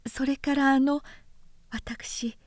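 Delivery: breathy secret murmur; hushed voice. Acted. Daughter lowers her voice to tell him secretly, whispering. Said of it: "巧みに声を潜めて".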